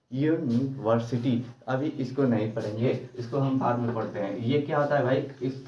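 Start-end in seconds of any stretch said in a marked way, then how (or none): 0.1-1.4 university said slowly, each syllable drawn out; slow demo word. Shown as a demonstration. Teacher to students, slowly sounding out a word. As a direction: spell out university slowly for the class.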